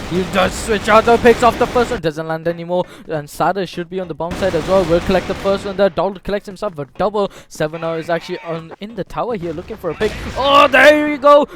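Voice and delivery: building to a loud, high shout; excited play-by-play. Real, not acted. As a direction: call the fight rising to a yell. Hyped live play-by-play calling.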